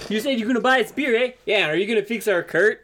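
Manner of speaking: quick Canadian asking, "eh?"; Canadian locals holding the party to their promise; Canadian accent. Acted. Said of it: "Hope my accent's spot on".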